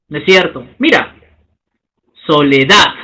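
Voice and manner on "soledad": soledad slow and low, rising onto the final -dad; stress on last syllable. Shown as a demonstration. Teacher showing students where soledad is stressed, stress on last syllable. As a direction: say soledad with the weight landing on dad. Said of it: "La curva asciende".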